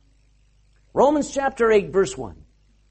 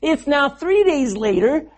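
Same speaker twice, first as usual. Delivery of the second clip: ordinary talk, nothing marked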